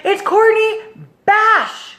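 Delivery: high name call, pause before surname; imitated announcer reveal. Host revealing the big-prize winner, announcer voice.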